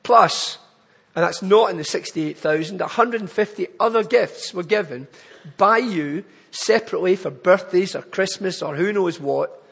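normal talking voice

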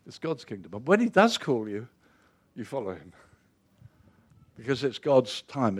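ordinary talk, nothing marked

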